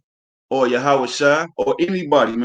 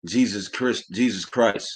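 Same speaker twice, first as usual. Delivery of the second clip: softer, lower "Jesus Christ" muttered under the breath; 5 semitones lower, 5 dB quieter, slower than usual. Retold or imitated. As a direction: mumble "Jesus Christ" like a careless curse. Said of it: "called him damn God and Jesus".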